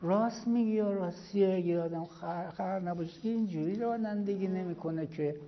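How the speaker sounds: cheerful, good-humoured agreement; smiling and friendly. Retold or imitated. Cleric smilingly agreeing with the angry driver. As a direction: agree with a smile, no anger. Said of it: "خیلی خندان و قشنگ".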